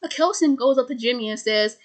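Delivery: usual speaking voice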